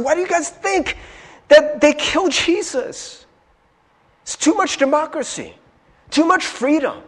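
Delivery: high, urgent pulpit proclamation; impassioned preaching. Real, not acted. Preacher urging the congregation into the kingdom, impassioned preaching. Preaches at raised pitch and force.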